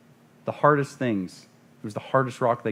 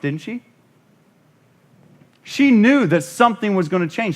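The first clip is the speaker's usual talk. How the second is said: loud, high, building sermon cry; preaching at full force; 8 semitones higher, 6 dB louder than usual. Acted. Preacher to congregation, rising fervent shout. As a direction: preach it out against the devil.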